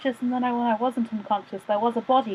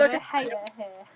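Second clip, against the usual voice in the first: laughing; 4 semitones higher than usual. Real, not acted. Laughing at the joke.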